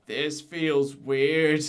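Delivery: slow, drawn-out imitation of Ray; lightly voiced Ray, slowed right down; slow impression of Ray. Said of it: "in the best impression I can give".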